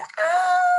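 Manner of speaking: squeaky high flying scream. Acted. Beaver and moose thrown up, squealing high. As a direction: shriek as if tossed in the air. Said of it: "and they went".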